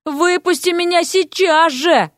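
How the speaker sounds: slow, high-pitched emphatic order; indignant demand. A narrator's reading. Vasya remembers she is captive, indignant. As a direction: demand release word by word.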